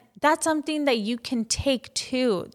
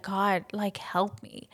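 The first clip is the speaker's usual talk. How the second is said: breathy whispered prayer, much softer; whispered plea; 3 semitones lower, 7 dB quieter, slower than usual. Retold or imitated. Crying on the way to work, whispered prayer. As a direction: pray it under your breath. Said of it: "cry to work and I would be like".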